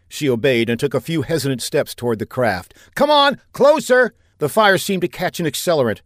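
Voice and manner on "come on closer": high, loud, drawn-out call; urgent shout. A narrator's reading. Saffinger urges the woman toward the craft, shouting. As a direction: shout her onward, slowly and hard.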